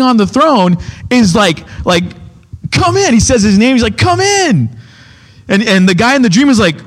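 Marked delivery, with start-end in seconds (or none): 2.7-3.1 much higher, gently beckoning voice; a lightly voiced regal welcome; throne man's welcome. Man on throne greets dreamer. 4.0-4.7 much higher, gently beckoning voice; a lightly voiced regal welcome; throne man's welcome. Man on throne greets dreamer.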